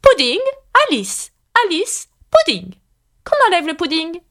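high-pitched, sullen royal introduction; sullen queen. A narrator's reading. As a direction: reel it off as a displeased queen. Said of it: "prit un air maussade et grommela". (reine maussade, voix aiguë)

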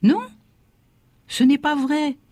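put-on high child's voice protesting; high-pitched little boy's protest; child crying out. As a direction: cry out like a small boy caught out. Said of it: "je criais".

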